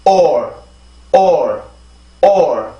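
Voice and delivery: "all" said as "or", rounded vowel curling into r; isolated "or" syllable, repeated with pauses; r-vowel drill. Shown as a demonstration. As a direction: model a falling, r-coloured "or" for "all". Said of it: "자, 해볼게요".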